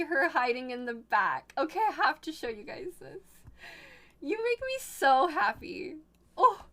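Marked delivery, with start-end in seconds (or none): 4.2-6.7 high, emotional gush; overcome with happiness. Real, not acted. Streamer to the picture's sender, moved and delighted. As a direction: squeal it out, moved almost to tears.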